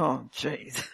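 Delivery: low, soft oh jeez under the breath; a muttered groan to herself; dismayed groan. Retold or imitated. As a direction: sink into quiet dismay. Inner reaction to being copied.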